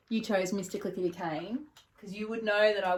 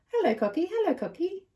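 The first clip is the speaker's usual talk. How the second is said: high sing-song hello; high, playful coaxing to a pet bird; 9 semitones higher than usual. Acted. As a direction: coo the words to the cockatoo. Teaching parrot words.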